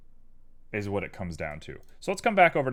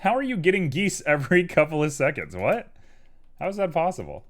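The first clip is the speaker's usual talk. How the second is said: raised, louder incredulous questioning; baffled disbelief; 7 semitones higher, 5 dB louder than usual. Real, not acted. Streamer puzzled by geese beating the cooldown, incredulous. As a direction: demand to know how on earth it happened.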